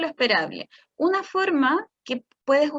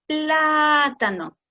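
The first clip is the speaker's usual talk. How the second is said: plátano said very slowly, each syllable stretched, louder; lengthened hard part; 4 dB louder, much slower than usual. Shown as a demonstration. Showing parents how to stretch plátano, very slow. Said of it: "le haces como más larga la parte difícil".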